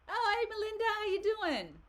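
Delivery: very high, loud sing-out hello; excited greeting. Real, not acted. Host spots a viewer arriving mid-question, excited high greeting.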